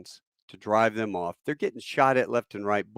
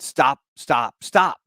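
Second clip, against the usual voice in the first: louder, rising urgency on each repeat; urgent order to halt; 4 semitones higher, 5 dB louder, much slower than usual. Retold or imitated. Bill tells the young kid to stop the truck, urgently. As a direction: call out a sudden halt.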